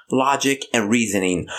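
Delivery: ordinary talk, nothing marked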